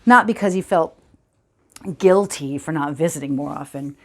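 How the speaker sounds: reflective storytelling with long, halting pauses; understated film-monologue delivery, mostly a little subdued; dramatic monologue. Acted. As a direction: recall a childhood memory piece by piece.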